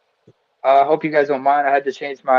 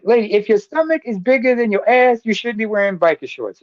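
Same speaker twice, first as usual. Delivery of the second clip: higher comic voice; imitating comedian Pierre; 7 semitones higher than usual. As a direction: deliver it like a standup punchline. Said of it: "He was like".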